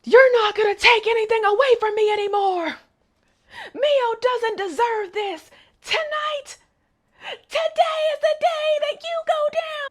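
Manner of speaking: high, crying, raging vow; furious cry. Retold or imitated. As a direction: scream it through tears at his father. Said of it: "really pissed".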